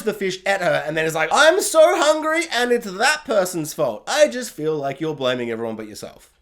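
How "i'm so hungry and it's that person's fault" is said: mock whiny peasant.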